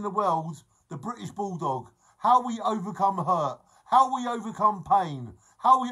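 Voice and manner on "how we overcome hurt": normal talking voice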